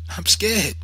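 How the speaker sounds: put-on high-pitched frightened child; scared boy. A narrator's reading. Boy balking at going out, high and scared. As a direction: plead fear in a small boy's high voice. Boy confesses fear to mother.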